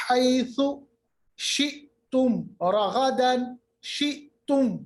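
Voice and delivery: slow recital, شِئْتُمْ in separate syllables; clearly sounded mim. Shown as a demonstration. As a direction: close شِئْتُمْ on a clear mim before رَغَدًا. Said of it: "دیکھیں میم کی آواز نکالی". (میم کی آواز نکال کر تلاوت)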